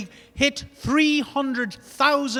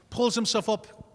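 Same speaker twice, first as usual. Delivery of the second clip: normal talking voice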